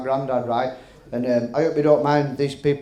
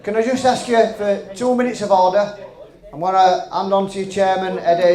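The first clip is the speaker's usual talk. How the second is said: calling out; 6 semitones higher, 6 dB louder than usual. Real, not acted. Calling the room to order.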